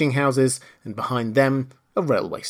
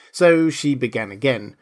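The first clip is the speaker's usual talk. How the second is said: ordinary delivery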